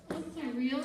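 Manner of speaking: normal talking voice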